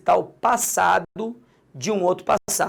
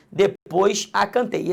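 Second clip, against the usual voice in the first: slow, "a" pressed hard in "Depois a cantei"; pointing students to the pronoun "a"; stress on a; slower than usual. Shown as a demonstration. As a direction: say it slowly and hit the pronoun "a". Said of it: "Esse a aqui".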